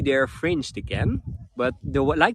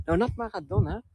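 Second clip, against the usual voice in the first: ordinary talk, nothing marked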